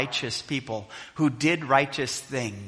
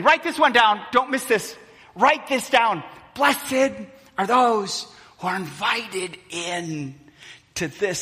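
urgent paraphrase; 5 semitones higher than usual. Acted. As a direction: drive it home, don't let them miss it. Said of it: "let me interpret this".

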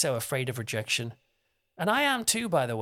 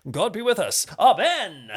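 booming, raised Charlton Heston-style prayer; older preacher; 5 semitones higher, 8 dB louder than usual. Shown as a demonstration. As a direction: proclaim it loud and high like an old-time preacher. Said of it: "how older types, older preachers might say".

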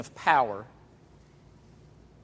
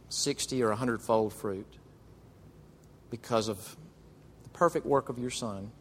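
prayer; 2 semitones lower than usual. Acted. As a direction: pray gently to close. Closing prayer.

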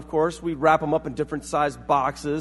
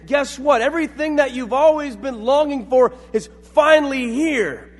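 loud, higher-pitched joyful announcement; proclaiming good news; 8 semitones higher, 6 dB louder than usual. Acted. Angels to shepherds with good news, proclaiming loudly. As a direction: announce it like a herald, high and loud. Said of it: "proclaim the good news and say".

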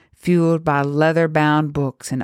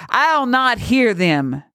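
defiant priest; 8 semitones higher, slower than usual. A narrator's reading. Priest refuses her words.

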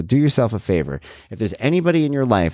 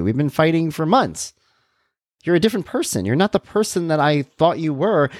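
higher-pitched, mildly exasperated venting; worn-out inner protest in a raised voice; 9 semitones higher than usual. Acted. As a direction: plead your exhausted case, pitched up. Voicing inner frustrated argument.

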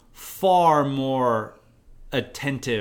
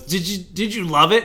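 higher, eager mock question; eager questioner; 6 semitones higher, 4 dB louder than usual. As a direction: imitate someone keen to hear you loved it. Acting out an eager asker.

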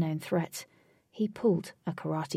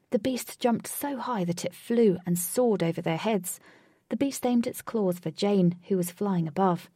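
usual speaking voice

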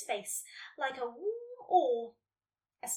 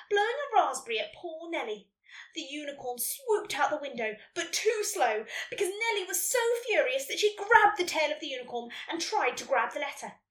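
ordinary delivery, nothing marked

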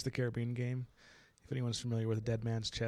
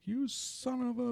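furious loser; 13 semitones higher, slower than usual. Retold or imitated. Loser lunges in rage.

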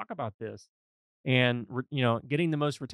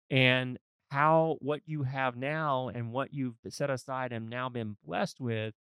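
usual speaking voice

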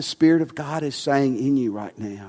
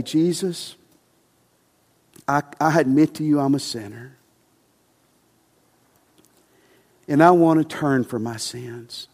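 sinner's prayer; 3 semitones lower than usual. Acted. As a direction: pray it gently for them to repeat.

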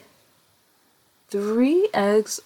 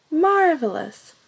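high, bright cheer; excited praise; 7 semitones higher, 4 dB louder than usual. Real, not acted. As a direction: cheer them on warmly. Praising the children.

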